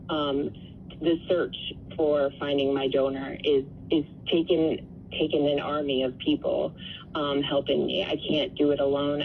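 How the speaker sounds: ordinary talk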